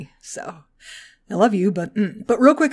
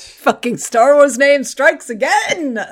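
exasperated; 7 semitones higher, 4 dB louder, slower than usual. Real, not acted. Mock exasperation at names.

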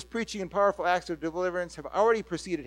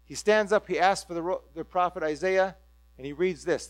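normal talking voice

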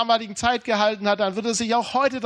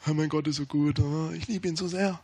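put-on devout gushing, kept low; fake piety; 3 semitones lower, 4 dB quieter than usual. Preacher mimics Christians hiding grief behind a smile, fake piety. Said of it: "dass man ein gefaktes Lächeln hat".

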